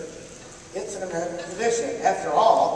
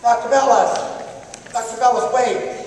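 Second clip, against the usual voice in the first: calling out; 6 semitones higher, 5 dB louder than usual. Acted. Running after teacher, calling his name.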